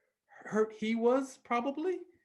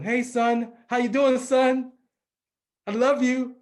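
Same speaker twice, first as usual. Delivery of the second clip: higher, louder voice of a greeting father; imitated happy dad; 5 semitones higher, 7 dB louder than usual. Dad answering his son's phone call, eagerly imitated. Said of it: "And I would call him".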